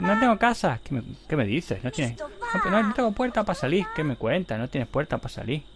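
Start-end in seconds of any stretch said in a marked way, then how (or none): 0.0-0.8 high, louder mock whine; mimicked complaint. 2.2-3.9 high-pitched mock protest; mimicked complaint. Mimics the character, then answers.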